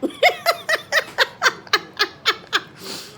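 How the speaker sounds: long, high-pitched laughing fit; helpless laughter. Real, not acted. First bite is bad.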